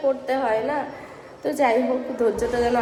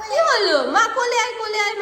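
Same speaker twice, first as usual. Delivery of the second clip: surprised; 8 semitones higher, 3 dB louder than usual. Real, not acted. Toddler suddenly fussing, mother reacts.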